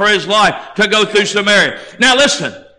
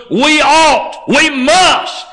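"we ought, we must" raised high, with weight on ought and must; emphatic imperative; 5 semitones higher, much slower than usual. Real, not acted. Preacher giving listeners a command, emphatic.

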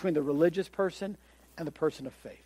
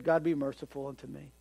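soft, bowed-head plea for mercy; humble prayer; 4 semitones lower, 7 dB quieter than usual. Retold or imitated. Tax collector humbly asking God for mercy, soft. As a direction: pray softer, head down. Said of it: "He prayed with his head down".

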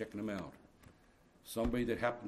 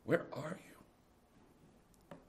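soft calling; 4 semitones higher, 8 dB quieter, much slower than usual. Acted. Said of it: "So he cries out".

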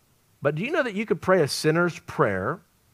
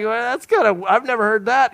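amazed; 8 semitones higher than usual. Retold or imitated. Diners astonished at free meal.

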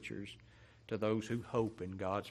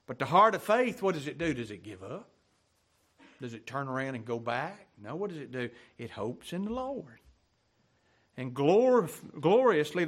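loud, high, rousing pulpit cry; full-voiced revival preaching; fiery preaching; 5 semitones higher, 6 dB louder than usual. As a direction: preach it out to stir the congregation. Preacher builds to climax.

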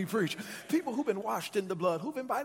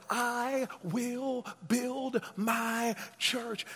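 very slow, every word held long; emphatic verse quote; 2 semitones higher, much slower than usual. Acted. Preacher gives reason he's glad, quoting Jesus, drawn-out and emphatic. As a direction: draw out each word as a proclamation.

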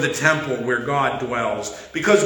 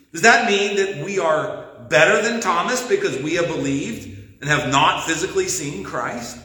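ordinary delivery

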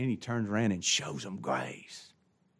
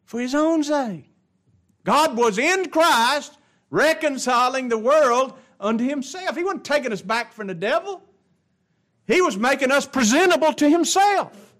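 high, loud pulpit preaching; full-force preaching; 12 semitones higher, 9 dB louder than usual. Acted. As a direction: preach it out to the congregation.

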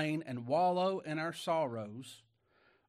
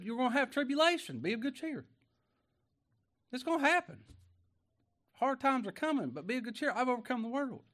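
preaching cheer; 11 semitones higher, 5 dB louder than usual. Acted. Preacher voicing the Lord's promise.

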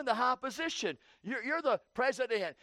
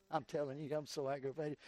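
muttered gripe; 7 semitones lower, 10 dB quieter than usual. Retold or imitated. Husband muttering his gripe.

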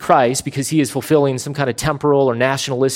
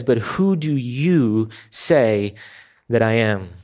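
slow solemn question; 3 semitones lower, slower than usual. Retold or imitated. Preacher quotes Jesus' question.

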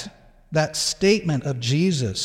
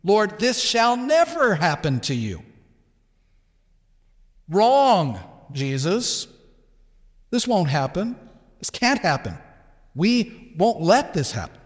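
emphatic acted rebuke, key words punched high; insistent disciple refusing to accept it; rebuking; 4 semitones higher than usual. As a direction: contradict your master point by point, with pauses between. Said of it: "Peter began to rebuke the Lord".

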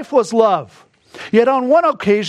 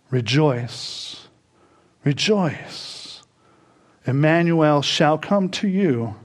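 fading hymn recital; 9 semitones lower, 5 dB quieter, slower than usual. Real, not acted. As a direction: recite the hymn, softer and slower to a whisper.

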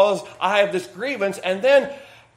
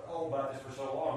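normal talking voice

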